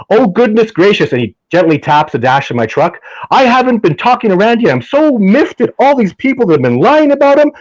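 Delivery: a polite man's huff, imitated up high; John getting angry. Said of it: "this is John getting angry".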